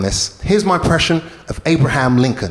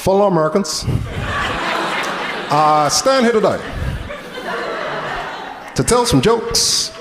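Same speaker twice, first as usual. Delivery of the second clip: measured presidential cadence with long pauses; Barack Obama; 3 semitones higher than usual. Retold or imitated. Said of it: "impression of Barack Obama as a stand-up comedian".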